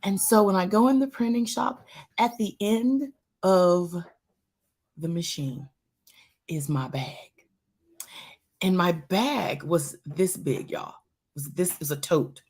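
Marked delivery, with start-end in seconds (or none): 5.0-7.3 breathy dramatic whisper. Acted. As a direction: hush it like a shocking reveal. Dramatic reveal of melted bag.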